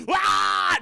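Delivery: loud high startled yell; imitated scream. Captain turning round onto the alien, startled scream. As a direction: yell out as if ambushed.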